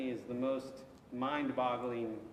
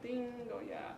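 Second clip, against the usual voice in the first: a higher, hammed-up "Ding, oh yeah"; a light cartoon light-bulb moment; mock sudden recall; 9 semitones higher, 5 dB quieter than usual. Teacher mocking the idea that God forgot, with a higher bright "ding". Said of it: "it wasn't like".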